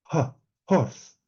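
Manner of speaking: a breath puff "ha", then "horse" with audible h; "horse" led by a breathy h; aspirated H. Shown as a demonstration. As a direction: isolate the h as air, then say "horse" with it.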